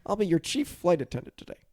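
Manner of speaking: ride-host welcome with a swooping hello; projected pre-ride announcer impression; Putty. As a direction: play the chief flight attendant greeting guests. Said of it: "Putty, is now my".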